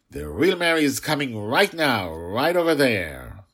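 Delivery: high, loud proclamation with stretched words; urgent summoning. Acted. Dreamer father tries to conjure the nanny back, insistent and loud. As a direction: announce it insistently, pitch swooping up.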